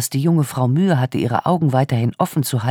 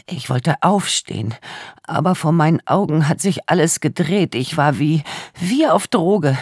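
put-on tearful young woman; 6 semitones higher than usual. A narrator's reading. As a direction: voice a traumatised young woman in tears. Tearful victim speaks.

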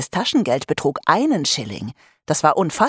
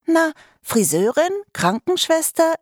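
much higher, bright listing voice; girl's eager listing; 11 semitones higher than usual. Acted. Norma counts off the four jobs to Vivian, high and eager.

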